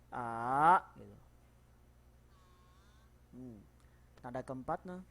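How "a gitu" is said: A drawn out slowly and rising. Shown as a demonstration. Teacher models the rising tone again. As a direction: let A climb slowly. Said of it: "dari rendah ke naik".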